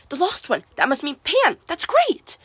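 high, eager excitement; perked up. A narrator's reading. Grover thinks the prophecy means Pan, excited. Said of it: "Grover perked up".

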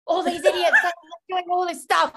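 high, loud scornful complaint; annoyed outburst. Retold or imitated. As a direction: spit it out in irritation. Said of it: "I was so annoyed".

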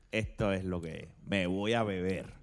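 imitating friend.

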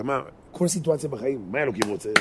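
ordinary talk

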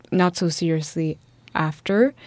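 ordinary talk, nothing marked

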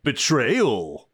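laid-back surfer drawl on "Betrayal"; surfer dude voice. Shown as a demonstration. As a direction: stretch it out like a surfer. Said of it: "say the word betrayal ... in a way that I really loved".

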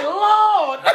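laughing church echo. Retold or imitated. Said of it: "She like".

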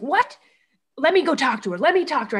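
startled protest. Retold or imitated. Accused husband to wife, startled and protesting. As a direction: jump up in his pitch, caught off guard.

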